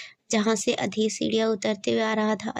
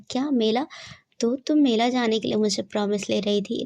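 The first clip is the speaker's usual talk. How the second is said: ordinary delivery, nothing marked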